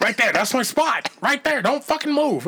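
put-on dad guarding the TV reception from the kids; bossy dad. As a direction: order everyone to freeze. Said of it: "your dad's talking to you like".

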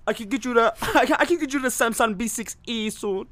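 hammed-up Indian accent selling phones; jokey Indian call-centre voice. Mock Indian salesman accent.

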